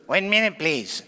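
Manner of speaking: small squeaky voice on the phone; thin little imitation voice. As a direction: imitate a tiny, squeaky caller. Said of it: "I heard this little voice".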